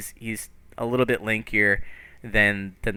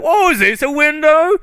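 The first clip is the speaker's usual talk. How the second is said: high, loud delighted gasp; a fairy thrilled by a gadget; high, loud wide-eyed wonder; Puck amazed; 14 semitones higher, 10 dB louder, slower than usual. Acted. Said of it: "And he's like".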